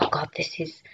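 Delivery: hushed, barely voiced awe; almost no voice, frozen beside your hero; starstruck whisper. Standing next to Noel, a starstruck hushed whisper.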